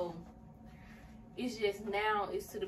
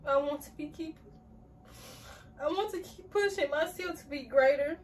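higher, louder, tear-choked reading; crying while reading; 7 semitones higher, 6 dB louder than usual. Real, not acted. Reading her journal to viewers through tears. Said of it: "I did not expect to cry".

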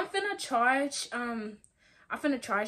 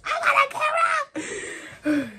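high, laughing and crying with joy; giddy and overwhelmed; laughing excitement; 5 semitones higher, 4 dB louder, slower than usual. Real, not acted. Celebrating her new camera, laughing. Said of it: "I am so freaking excited".

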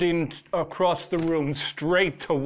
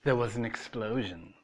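plain reading voice

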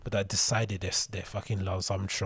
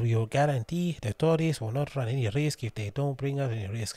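higher-pitched bad accent that slips into Irish; a shaky-footed, Irish-sounding put-on accent; botched foreign accent; 4 semitones higher than usual. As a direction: attempt a foreign accent and land vaguely Irish. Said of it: "that sounds like Irish. I'm not gonna do accent".

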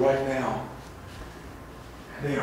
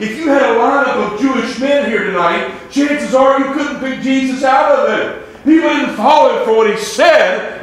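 loud, high preacher's cry; a revival preacher at full force; full-force preaching; 8 semitones higher, 10 dB louder than usual. Acted. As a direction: preach it out to the whole church. Preaching on Jesus' appearance.

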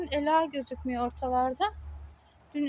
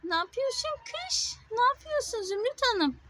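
cooing to a cat; 10 semitones higher than usual. Real, not acted. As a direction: coo at the kitty in a high voice. Talking sweetly to a stray cat. (kediye yüksek sesle sevgiyle seslenme)

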